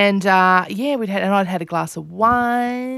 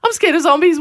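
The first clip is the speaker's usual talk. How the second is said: high, louder imitation of a scared kid; little child's voice; 9 semitones higher, 6 dB louder, faster than usual. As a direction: mimic a child insisting she's frightened. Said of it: "I hear a little voice go".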